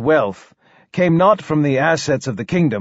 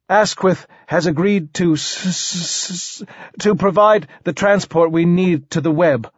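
higher voice, stuck on the S; stuttering king; 4 semitones higher than usual. Acted. King explains transport to poet, stammering. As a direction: get stuck mid-sentence, then carry on.

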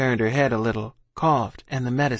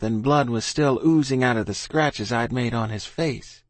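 plain reading voice

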